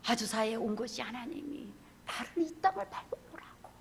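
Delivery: normal talking voice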